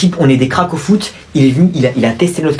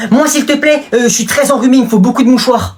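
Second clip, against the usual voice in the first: mock pleading; 10 semitones higher than usual. Pupil begging the boy for tissues, high and pleading. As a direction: beg like a needy child.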